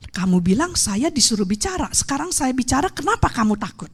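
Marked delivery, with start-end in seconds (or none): none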